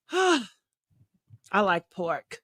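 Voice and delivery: low, soft, sighing longing for pork; wistful sigh. Real, not acted.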